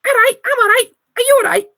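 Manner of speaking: high, loud squeaky little mouse. Acted. GM voicing a tiny Christmas mouse, squeaky and high.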